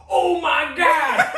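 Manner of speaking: high, loud, drawn-out laughing cry; shocked shout. Retold or imitated. Teammate seeing the broken hand, shocked laughing shout.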